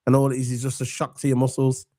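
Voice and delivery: usual speaking voice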